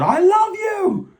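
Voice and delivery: high, drawn-out adoring scream; retold fan yell. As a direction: re-enact a fan yelling with all their heart. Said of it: "And I just yelled".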